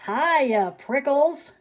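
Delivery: shouted greeting. Acted. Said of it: "she shouted".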